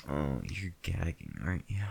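usual speaking voice

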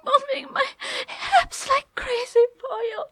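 high, breathless, teary lovemaking; tearful passion. Acted. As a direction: gasp it out close to tears.

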